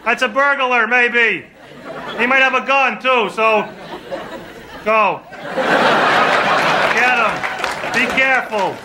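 hammed-up wife pushing husband out of bed; mock nervous wife. Wife voiced, sending husband to check.

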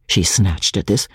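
ordinary delivery, nothing marked

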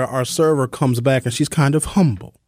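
ordinary talk, nothing marked

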